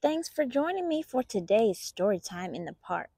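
plain reading voice

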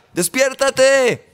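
high, drawn-out acted call; urgent wake-up call. Preacher waking a man living a nightmare, urgent acted call.

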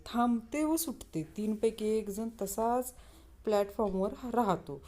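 ordinary delivery

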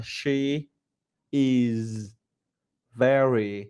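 "She is very beautiful" split into single words with long pauses; slow, spaced teacher demo. Shown as a demonstration. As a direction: separate every word for practice. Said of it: "thầy phải tách từng câu ra".

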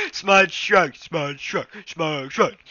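high, loud goofy repeats; silly voice. Pretend. Silly repeats of tripped-over line.

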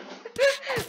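sudden high burst of laughter; a real laugh that blasts the ears; loud laugh. Real, not acted. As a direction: cackle out loud into the mic. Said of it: "vi avrò esploso le orecchie con la mia risata".

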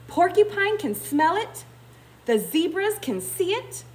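high-pitched storybook calling; crying out. A narrator's reading. Baboons passing on the porcupine's news, crying out. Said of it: "cried the baboons".

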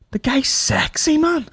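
high slow admiring voice; mock swooning. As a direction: swoon over him, slowly and high. Mock admiring [name].

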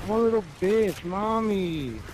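whiny crybaby impression. Mocking a rival player as a whiny crybaby. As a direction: whine it like a spoiled kid crying to mommy. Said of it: "Look at me bitching and crying".

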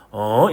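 어 dipping, then rising at the end; question-mark tone. Shown as a demonstration. Teacher following the question mark, 어 curling upward. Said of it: "물음표 따라".

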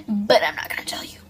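teasing whisper. Real, not acted. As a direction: whisper it like a secret. Teasing secret about next video.

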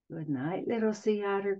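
soft, tender coo. Acted. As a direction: coo it like a mother at bedtime. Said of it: "cooed Mama".

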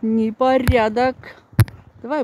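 ordinary talk

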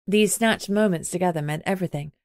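usual speaking voice

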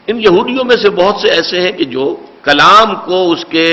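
normal talking voice

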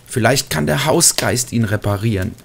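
ordinary delivery

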